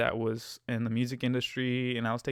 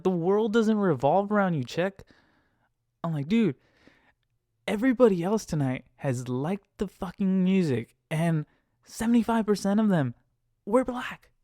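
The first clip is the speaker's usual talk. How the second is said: higher, forceful retold rant; heated retort; 7 semitones higher, 4 dB louder than usual. Driver telling off a passenger who disliked the music, heated retort. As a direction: hammer it out, indignant.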